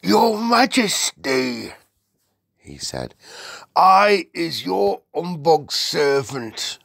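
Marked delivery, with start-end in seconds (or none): 0.0-1.7 high, loud, drawn-out giant voice; reverent giant. Acted. BFG greets the Queen, reverent giant voice. 3.7-6.8 high, loud, drawn-out giant voice; reverent giant. Acted. BFG greets the Queen, reverent giant voice.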